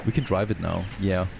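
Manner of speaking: ordinary talk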